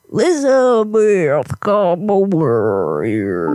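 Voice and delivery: very slow, sinking low drawl; exaggerated slow-down. Shown as a demonstration. Caller mockingly slows it down for Elizabeth, exaggerated slow low drawl. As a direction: stretch every word like a record slowing down. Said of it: "I could slow it down for you".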